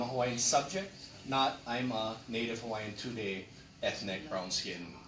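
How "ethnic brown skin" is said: normal talking voice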